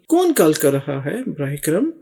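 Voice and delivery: ordinary delivery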